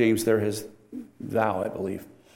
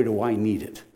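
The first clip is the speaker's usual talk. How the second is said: ordinary talk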